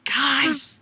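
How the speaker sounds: high, hushed squeal; overwhelmed excitement. Real, not acted. Fan tells co-hosts about the book release, overwhelmed excitement. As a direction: squeak out thrilling news, softly and very high.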